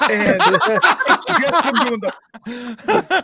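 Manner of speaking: high, slow, breaking into laughter; laughing. Real, not acted. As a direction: talk through a laugh. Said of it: "They thought he was cussing".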